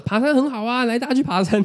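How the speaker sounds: high-pitched, eager teacher rallying the class; cheerful urging. Retold or imitated. Teacher urging reluctant pupils up the mountain, high-pitched cheerful urging. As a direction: cheer them on, voice lifted high.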